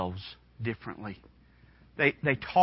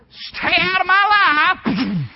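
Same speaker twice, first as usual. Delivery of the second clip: high, loud, slow defiant shout; rebellious teen yell; 10 dB louder, much slower than usual. Retold or imitated. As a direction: yell it like slamming the door. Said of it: "slam a door just to get the full effect".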